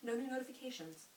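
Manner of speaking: ordinary talk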